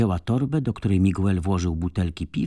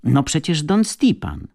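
high, loud, drawn-out answer; eager, pitched-up exclamation; excited insistence; 8 semitones higher, 5 dB louder than usual. A narrator's reading. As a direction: insist as if it were obvious. Tatiana excitedly states the obvious.

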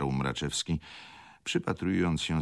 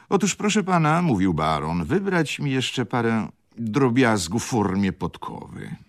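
higher, hesitant aristocratic baron with ums; fussy baron; 9 semitones higher, 8 dB louder than usual. Acted. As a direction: play the dithering baron fishing for words. Baron orders more trinkets.

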